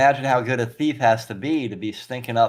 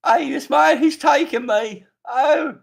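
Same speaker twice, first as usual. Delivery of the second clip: much higher, louder, squeaking cry for a guard; high squeaky voice; 5 dB louder, slower than usual. Acted. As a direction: shriek up high like a new voice. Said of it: "his voice changed too".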